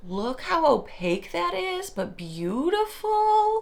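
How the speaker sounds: delighted, pitch climbing high. Real, not acted. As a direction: gush over it, stretching beautiful. Admiring product.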